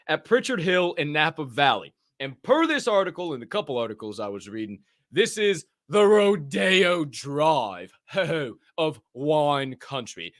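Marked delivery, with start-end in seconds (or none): 5.1-10.3 slow grand emphasis. Pretend. Posh wine emphasis.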